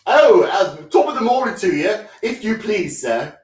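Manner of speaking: high, loud hammed-up cockney; stage cockney greeting, pitched high; cockney accent. Shown as a demonstration. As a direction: chirp it out in an overdone cockney accent.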